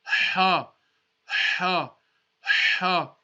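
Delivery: ha breathed from the middle of the throat; Arabic letter Ha from the throat; throaty ha. Shown as a demonstration. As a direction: push each ha from the throat. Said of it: "Just like the Jim Ha letter".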